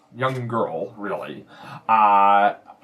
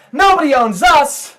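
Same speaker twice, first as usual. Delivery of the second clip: put-on high, loud kid shout; acted small-boy defiance; defiant little boy; 13 semitones higher, 9 dB louder than usual. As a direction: pipe up in loud protest. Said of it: "little boy from behind the bar".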